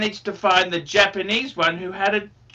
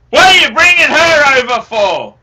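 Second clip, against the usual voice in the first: loud, raised mock-angry father; dad's shout; 5 semitones higher, 9 dB louder than usual. Son retells father scolding him, loud and high. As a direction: bellow it like a gruff old dad. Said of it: "he goes".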